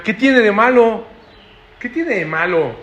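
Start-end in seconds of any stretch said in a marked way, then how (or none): 0.0-1.1 slow, loud and raised, pushing back; rebellious child defying an old-fashioned parent; defiant taunt. Acted. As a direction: challenge the parent, drawn out. Children mocking a parent's old ways.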